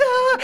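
high, loud imitated toddler bawl; hammed-up child's cry; mock crying wail. Three-year-old crying in the car, high mock wail. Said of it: "and she's crying".

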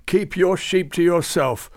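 put-on furious old saint roaring. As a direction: bellow it back at him. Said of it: "roared Babucar".

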